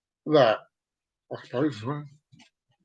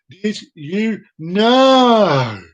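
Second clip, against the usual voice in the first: very slow, louder stretched question; a slow teasing call; drawn-out catchphrase; 3 semitones higher, 4 dB louder, much slower than usual. Pretend. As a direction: draw it out for suspense.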